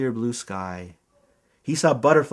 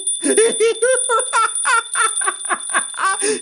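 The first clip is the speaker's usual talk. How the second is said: high, giggly belly laugh; delighted laughter. A narrator's reading. Zookeeper tickled by the bug, high giggly laughter. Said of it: "He exclaimed".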